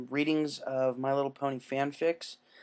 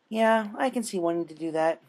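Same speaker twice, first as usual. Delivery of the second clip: higher, mock-understanding pony voice; sympathetic Pinkie; 5 semitones higher, 3 dB louder than usual. Pinkie replies to Dash, high and falsely kind. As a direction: fake empathy from a cheerful captor.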